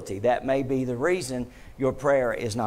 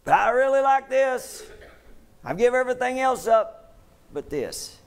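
much higher, pleading whine; mock churchgoer; 10 semitones higher than usual. Preacher mimicking a stubborn churchgoer, high pleading whine. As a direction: play a believer begging to keep it.

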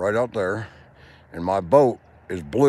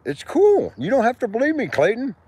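much higher, coaxing voice; 12 semitones higher, 3 dB louder than usual. Real, not acted. Man coaxing Clayton to believe him, high voice. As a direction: coax him gently from up high.

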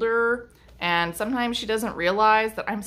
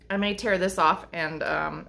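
usual speaking voice

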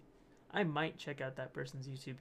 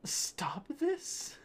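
high, rising, airy question on THIS; high-pitched bewildered read; incredulous reading; 11 semitones higher than usual. Acted. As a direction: squeak it out as a baffled question. Reading a meme aloud incredulously.

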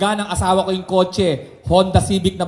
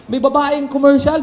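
normal talking voice

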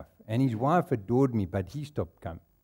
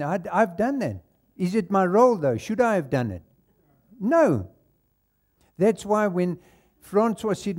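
ordinary talk

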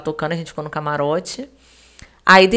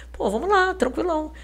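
higher-pitched, breezy okay; feigned casual; 9 semitones higher than usual. Retold or imitated. Woman playing it cool for her client, feigned casual. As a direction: act like it's totally no big deal. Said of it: "como se fosse uma coisa super de boa".

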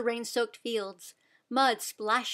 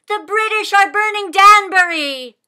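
much louder, higher alarm; urgent cry; 9 semitones higher, 15 dB louder than usual. A narrator's reading. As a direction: sound the alarm. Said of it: "Sybil cried".

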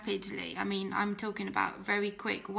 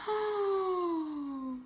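dismayed gasp; 8 semitones higher than usual. Retold or imitated. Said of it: "And I was like".

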